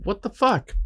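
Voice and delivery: startled, high-pitched What the fuck; sudden disbelief; shocked. Retold or imitated. Shocked inner thought.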